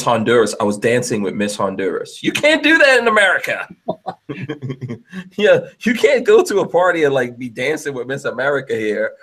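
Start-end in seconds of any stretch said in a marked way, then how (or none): 2.2-7.0 high, loud and gleeful, marveling; you can't believe your luck, say it like that; excited exclamation. Real, not acted. Bragging to friends about dancing with Miss Honduras, high excited exclamation.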